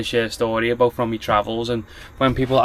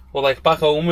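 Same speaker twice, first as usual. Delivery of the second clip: usual speaking voice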